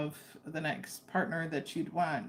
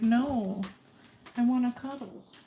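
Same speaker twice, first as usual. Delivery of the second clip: raised voice of a needy pet; playfully voicing the dog; 5 semitones higher than usual. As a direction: speak as a dog demanding affection. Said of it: "He's like".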